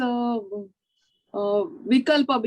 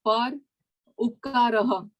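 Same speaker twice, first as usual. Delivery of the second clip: Hindi accent. Real, not acted. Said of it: "only because you have a Hindi accent".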